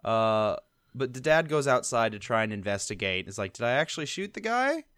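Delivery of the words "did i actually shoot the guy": higher, softly puzzled question; mock puzzlement, pitched up. Host voices the dad checking outside, puzzled.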